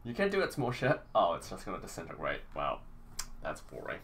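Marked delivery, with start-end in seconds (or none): none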